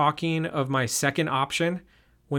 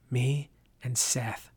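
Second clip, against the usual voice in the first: slow, breathy whisper; 5 dB quieter, slower than usual. Acted. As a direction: keep it secret from the others. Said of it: "whispered to me".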